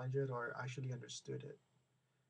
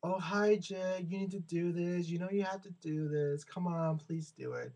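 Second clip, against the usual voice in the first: imitated counselor, higher and friendly, coaxing; sweet asking; 5 semitones higher, 5 dB louder than usual. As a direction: ask nicely, almost pleading, like you favor him. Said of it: "the other one was asking".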